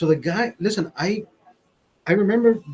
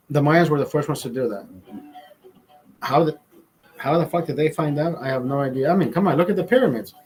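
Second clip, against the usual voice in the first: ordinary talk, nothing marked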